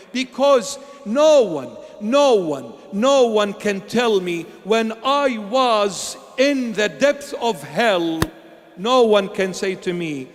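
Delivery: loud, slow, hammered "no one"; forceful declaration. Real, not acted. Preacher defying those who judge him, loud and slow. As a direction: drive each "no one" home.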